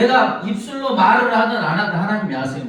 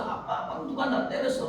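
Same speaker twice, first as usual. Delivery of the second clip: mock crying child; 6 semitones higher, 8 dB quieter than usual. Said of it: "막 엉엉 울면서".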